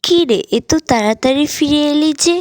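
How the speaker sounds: mildly surprised mother, voice lifted. A narrator's reading. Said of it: "মা অবাক হয়ে বললেন".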